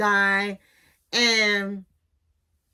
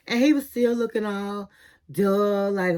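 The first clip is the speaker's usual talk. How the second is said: ordinary talk, nothing marked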